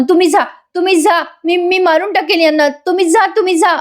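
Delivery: high, agitated yell at ordinary volume; mock hysterical yell. Suman feigns hysteria to drive Nagraj away, mock yelling. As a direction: act out hysteria, repeating it at high pitch. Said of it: "नागराजला मोठ्याने ओरडून म्हणते सुमन".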